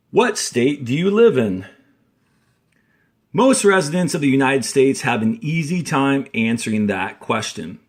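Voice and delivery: clear, steady read-aloud of a textbook passage; reading aloud to class. Acted. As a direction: read the text out for students to follow along. Teacher reads the passage aloud.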